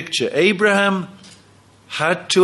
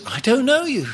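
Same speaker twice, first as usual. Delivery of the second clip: Jesus rejecting; 8 semitones higher than usual. Acted. Jesus rejecting foolish virgins.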